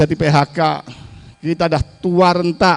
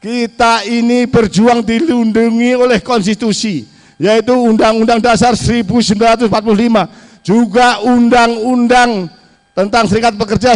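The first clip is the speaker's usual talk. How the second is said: usual speaking voice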